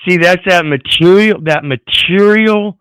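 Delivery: fired-up preaching on material; heated emphasis. Real, not acted. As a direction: pound the word material, slowing on the repeat. Ranting about material possession.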